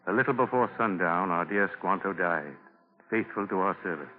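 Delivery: ordinary talk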